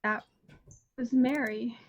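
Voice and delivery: dissociating, drained monotone with long gaps. Acted. Shaken character naming who that was, very monotone. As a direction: let it go slack, halting and toneless. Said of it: "like, very monotone".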